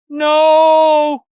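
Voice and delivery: very high, loud drawn-out wail; wailing scream. A narrator's reading. Luis on the floor refusing, drawn-out wail. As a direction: wail it long at full voice. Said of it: "Luis wails, racked with sobs".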